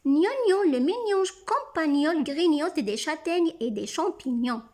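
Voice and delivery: rapid tongue-twister reading; as fast as possible. Shown as a demonstration. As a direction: race through the sentence without pausing. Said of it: "lire la phrase le plus vite possible". (le plus vite possible)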